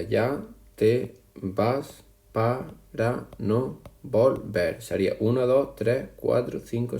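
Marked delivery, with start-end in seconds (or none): 0.0-4.8 ya te vas para no volver stretched slow, syllable by syllable; slow syllable reading. Shown as a demonstration. Teacher to class, reading the verse slowly to count syllables. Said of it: "Si lo contamos, sería".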